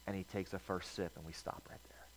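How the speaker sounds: trailing off into a hushed whisper; fading to a whisper. Acted. Preacher pausing the story at its climax, fading to a whisper. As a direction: let it drop to a breath. Storyteller halts at dramatic moment.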